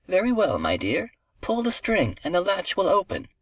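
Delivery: high-pitched grandmother voice. A narrator's reading. Grandmother in bed lets her grandchild in, high lilting voice. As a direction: answer kindly in a high, lilting voice.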